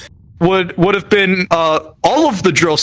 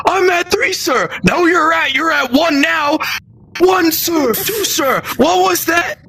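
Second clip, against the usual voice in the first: high, strained push-up count barked out; mock drill shouting; 12 semitones higher than usual. Trainees counting push-ups for a drill sergeant, mock shouting. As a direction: imitate drill sergeant and trainee yelling back and forth. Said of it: "proceeding to shout at us".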